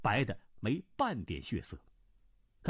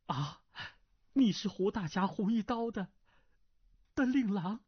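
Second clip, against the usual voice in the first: higher, faltering woman's voice; shaken noblewoman; 9 semitones higher than usual. Acted. Nan Lan stunned to learn who he is, high and faltering. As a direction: voice her high and unsteady, caught off guard.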